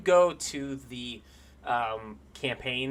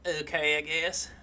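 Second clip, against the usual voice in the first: put-on soft, reluctant Southern-accented dwarf; hesitant and wary, in a hushed Southern accent; thick southern accent; 9 dB quieter than usual. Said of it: "he's got a thick southern accent".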